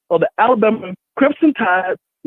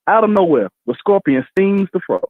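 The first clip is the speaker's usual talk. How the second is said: ordinary delivery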